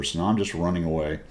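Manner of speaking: ordinary delivery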